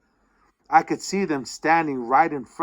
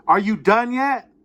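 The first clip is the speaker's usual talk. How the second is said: calling out; 8 semitones higher, 5 dB louder than usual. Acted. Dialogue line, calling out.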